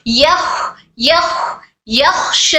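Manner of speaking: "यख" with the final kh left hissing with breath; don't stop the air on the kh in "यख"; airflow on kh. Shown as a demonstration. Teacher repeats the example for learners, "यख" with airy kh. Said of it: "खो में air flow होना है".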